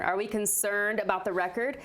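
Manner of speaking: usual speaking voice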